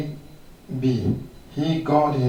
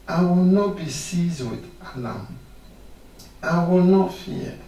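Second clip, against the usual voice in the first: louder, rising declaration; bold preaching; 4 semitones higher, 3 dB louder than usual. Acted. Preacher leading the church to say it boldly, fervent. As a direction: declare it boldly like a rally cry.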